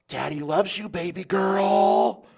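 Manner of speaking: put-on slow, crooning baby talk, stretched out; creepy pet talk. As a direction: coo it close and creepy. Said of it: "leaned in real close and went".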